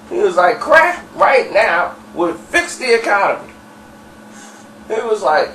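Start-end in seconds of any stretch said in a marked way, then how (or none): 0.6-3.5 high, emphatic proclamation; impassioned pitch. Retold or imitated. Dirty pitching crack as economic cure, impassioned. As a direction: sell the absurd idea with conviction. Acted rant praising crack.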